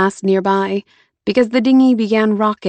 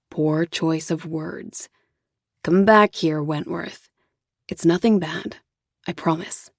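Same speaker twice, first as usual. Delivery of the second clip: lower, softer, patient man soothing her; 3 semitones lower, 4 dB quieter than usual. Acted. Jonah coaxes Lauren back into his arms, low and soft. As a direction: coax her back softly, low and calm.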